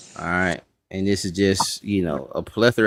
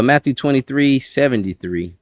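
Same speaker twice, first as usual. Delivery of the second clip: ordinary delivery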